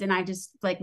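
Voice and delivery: ordinary delivery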